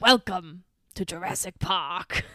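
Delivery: slow, grand welcoming impression; Hammond. Podcaster as Hammond presenting the park, slow grand welcome. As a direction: announce it slowly and grandly in a put-on voice. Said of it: "And Hammond goes".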